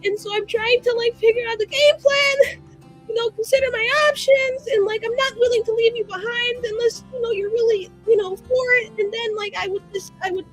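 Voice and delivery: high, breathless nervous ramble; anxious pleading. Acted. Begging friend Cyril to run away together, anxious pleading.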